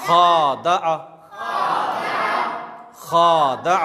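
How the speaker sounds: drawn-out खा in खादा; stretched first vowel. Shown as a demonstration. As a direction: lengthen खा in खादा. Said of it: "खा पर खींचना".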